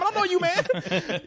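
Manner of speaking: laughing high slow mock brush-off; mock wife's brush-off. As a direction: drawl it out high through a laugh. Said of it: "same time".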